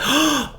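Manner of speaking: sharp high quiet gasp; imitated gasp of amazement; amazed gasp. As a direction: suck in air in awe. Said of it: "I wanna be like".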